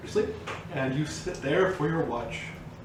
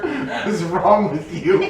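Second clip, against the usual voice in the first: slow, laughing disbelief; 10 semitones higher, 8 dB louder, slower than usual. Real, not acted. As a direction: laugh through the words. Friends laughing over snore sounds.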